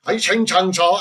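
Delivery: badly faked Chinese accent; mock Chinese accent. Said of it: "That's Chi-, that's Chinese".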